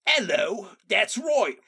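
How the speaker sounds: hammed-up British greeting; British accent. Co-host greeting the show in a put-on British accent. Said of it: "I cannot do my British accent".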